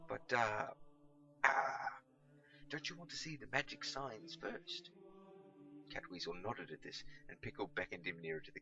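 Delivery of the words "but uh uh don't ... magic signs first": high, sing-song coaxing; coaxing lure. Acted. Pickle coaxes Catweasel to the cupboard, sing-song lure. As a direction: lure him toward the cupboard.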